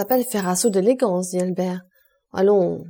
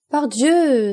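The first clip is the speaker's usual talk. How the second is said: higher-pitched, hearty exclamation; exclaiming; 5 semitones higher, 4 dB louder than usual. A narrator's reading. Franz to Albert about his idea, exclaiming. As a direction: cry it out with sudden delight. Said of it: "S'écria Franz".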